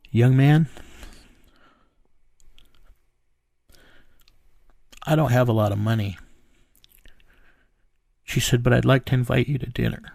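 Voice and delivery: real emotion, halting with long pauses; emotional. Real, not acted. Retelling the woman inviting him to dinner, emotional and halting. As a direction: stop and gather yourself between phrases, holding feeling back. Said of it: "You got emotional telling me".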